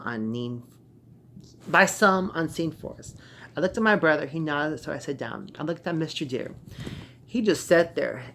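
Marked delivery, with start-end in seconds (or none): none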